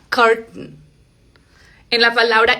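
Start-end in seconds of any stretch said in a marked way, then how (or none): 0.1-0.8 "curtain" cut at the T, then a nasal n; glottal T. Shown as a demonstration. Teacher showing where the T stops, glottal T. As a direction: stop the air in the throat on the T of "curtain". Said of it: "se detiene acá".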